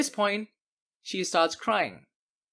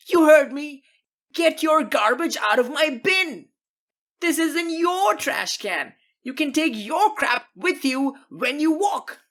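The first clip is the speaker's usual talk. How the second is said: much higher, much louder, slow ranting yell; furious shouting; 10 semitones higher, 6 dB louder than usual. Acted. Homeowner screaming at teen to take his trash, furious. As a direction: lose it completely over a soda can. Neighbor keeps yelling.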